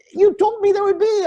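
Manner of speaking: high, loud, panicky retold protest; frantic protest. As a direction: blurt it out high and loud like you're losing your cool. Traveller freaking out at clerk.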